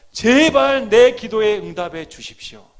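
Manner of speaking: loud, high begging prayer; desperate earnest plea. Acted. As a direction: beg God with full force. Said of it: "간절함이 묻어나야 되는 것입니다".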